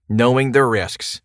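usual speaking voice

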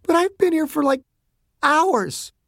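high, tight, tearful voice; distressed girl close to crying; fighting back sobs. A narrator's reading. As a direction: hold the sobs back as you protest. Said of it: "fighting back sobs".

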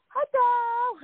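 high, drawn-out greeting; imitated wife's hello. Wife greets her on FaceTime, imitated high hello. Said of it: "his wife goes".

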